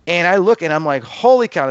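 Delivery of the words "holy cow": high, loud burst of disbelief; a startled holy cow; amazed exclamation. Retold or imitated. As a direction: blurt it out in shock. Shocked at near-miss scene.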